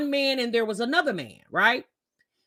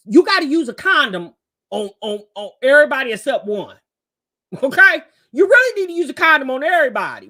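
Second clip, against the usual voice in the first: louder, pressing advice straight at the viewer; emphatic warning; 7 dB louder than usual. Real, not acted. Vlogger urging her female viewers, emphatic and loud. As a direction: lean in and insist, like a big sister. Urging women watching to use condoms.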